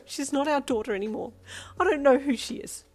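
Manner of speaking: high, loud, bitter cry; a mother rejecting her child; anguished outburst. Acted. Mum disowns daughter, leaving.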